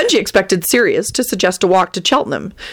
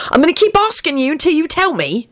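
high-pitched impatient nagging; dogged, impatient pestering; 11 semitones higher than usual. A narrator's reading. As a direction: insist impatiently, pitched well up. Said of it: "Sirius repeated impatiently".

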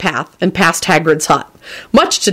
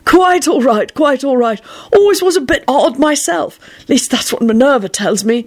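put-on higher, airy old eccentric; amused Dumbledore; 7 semitones higher than usual. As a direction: reassure him with amused whimsy.

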